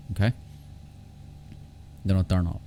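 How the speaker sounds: ordinary delivery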